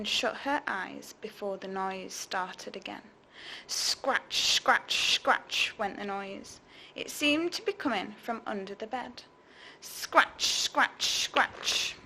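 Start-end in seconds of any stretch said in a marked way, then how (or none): 3.7-5.7 whispered scratching noise; scratching sound. A narrator's reading. 9.8-12.0 whispered scratching noise; scratching sound. A narrator's reading.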